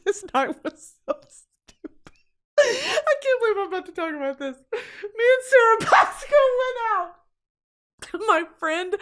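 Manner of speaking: high, squeaky laughing voice; helpless laughter. Real, not acted. Friend about to confess a stupid night, laughing helplessly. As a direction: struggle to speak through laughter. Too tickled to tell the story.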